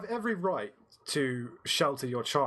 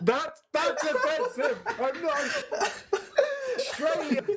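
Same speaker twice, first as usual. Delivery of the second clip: mock-offended, high and laughing; laughing protest; 12 semitones higher, slower than usual. Real, not acted. British friend laughing, protesting the Australian impression. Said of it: "I got offended".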